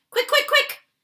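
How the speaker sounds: very high loud frantic calls; frantic high giant's cries; urgent. Acted. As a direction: hurry her, frantic. Urgent catching dream.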